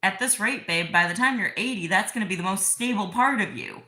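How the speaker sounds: playful loud jab; loud teasing. Real, not acted. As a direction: tease him, loud and bright. Teasing partner loudly, laughing.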